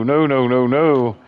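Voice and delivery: ordinary talk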